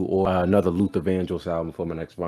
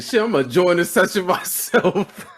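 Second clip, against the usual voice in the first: can't keep it together quoting dad; laughing while quoting; 10 semitones higher, 3 dB louder than usual. Real, not acted. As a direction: quote breaking into real laughter.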